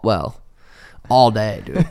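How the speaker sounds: normal talking voice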